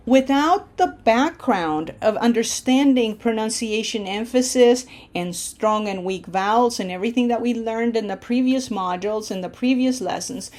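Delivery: ordinary talk, nothing marked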